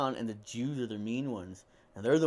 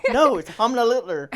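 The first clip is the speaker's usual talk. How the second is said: high, loud laughing insist; laughing correction; 11 semitones higher, 14 dB louder than usual. Retold or imitated. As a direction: correct them while cracking up.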